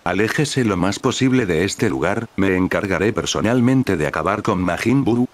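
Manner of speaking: ordinary delivery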